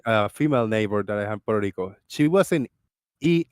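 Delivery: ordinary talk, nothing marked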